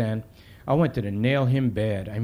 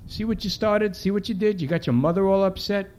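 higher-pitched reproachful questioning; scolding; 7 semitones higher than usual. Acted. Cop to crying kid in the back seat, scolding. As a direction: lay the blame on him, pitch raised.

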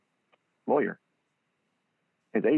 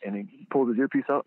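ordinary delivery

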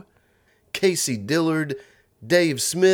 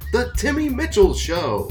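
big, high showbiz announce; announcer voice; 7 semitones higher than usual. Acted. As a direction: sell the show title. Announcer names the show.

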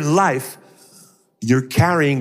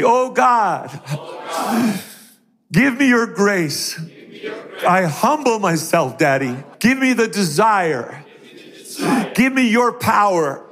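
higher, projected prayer in measured phrases; leading congregational prayer; 6 semitones higher than usual. Real, not acted. Preacher leading the audience to pray forgiveness, fervent prayer. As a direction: call out each phrase for repeating.

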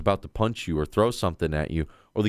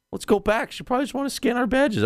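high retelling. Retold or imitated. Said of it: "I was like".

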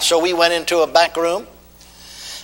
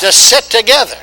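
preaching call; 6 semitones higher, 6 dB louder than usual. Acted. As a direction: chant it out line by line. Call and response.

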